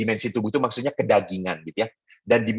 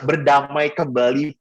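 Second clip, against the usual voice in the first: ordinary delivery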